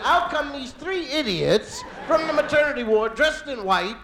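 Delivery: usual speaking voice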